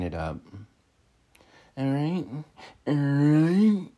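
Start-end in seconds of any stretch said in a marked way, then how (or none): 2.9-3.9 high, loud, stretched-out put-on voice; exaggerated drawn-out sign-off. Speaker clowning at the sign-off, exaggerated drawn-out voice. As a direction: draw it right out, high and loud.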